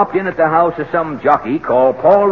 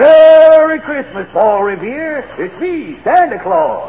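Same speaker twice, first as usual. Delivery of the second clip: booming Santa greeting; 8 semitones higher than usual. Acted. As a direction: belt it out like Santa.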